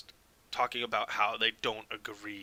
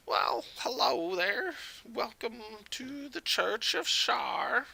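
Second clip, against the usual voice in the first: high-pitched, unhurried half-elf cleric; welcoming older priestess; 12 semitones higher than usual. Acted. Game master voices church priestess.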